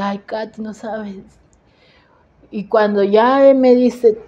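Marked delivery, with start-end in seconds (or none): 0.0-1.2 soft, downcast retold reply; hushed, understated sorrow; tearful husband. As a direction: lightly imitate a distressed husband, much softer. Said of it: "veo a mi esposo llorando".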